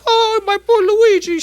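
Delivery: loud, very high mock wail; hammed-up grieving Italian widow; Italian wife. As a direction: wail slowly in a comic falsetto. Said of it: "the Italian wife says".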